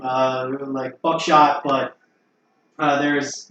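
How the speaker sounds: usual speaking voice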